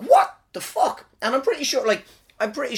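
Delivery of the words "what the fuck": high, slow, stretched-out incredulous cry; a squeaky, drawn-out what-the-hell; shocked disbelief. Retold or imitated. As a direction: sound utterly baffled by it. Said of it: "I'm like".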